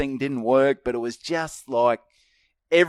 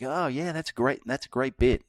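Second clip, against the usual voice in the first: Mark Norman impression; 4 semitones higher than usual. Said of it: "He doesn't sound anything like that".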